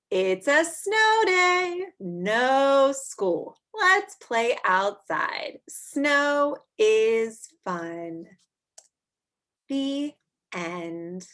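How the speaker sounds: slow, happy storybook ending; cheerful read-aloud. Acted. Teacher finishing the snow book for kids, slow and cheerful. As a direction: savor the fun ending.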